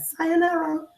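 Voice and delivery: a high, soft, mocking farewell; breezy, teasing Sayonara; cheeky goodbye. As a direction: wave them off with a sly send-off. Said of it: "and I said".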